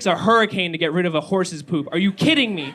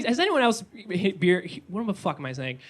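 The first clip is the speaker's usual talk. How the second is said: usual speaking voice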